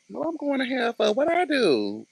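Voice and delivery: high-pitched incredulous squeal; mock dismay. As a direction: play someone shocked at being damned, voice way up. Acts dismayed person told they're damned.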